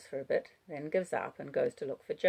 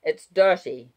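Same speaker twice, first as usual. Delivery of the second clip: louder warning cry; 3 semitones higher, 11 dB louder than usual. A narrator's reading. Wallace warning the child off, crying out. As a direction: call it out urgently, moderately raised. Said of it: "cries Wallace, making her jump".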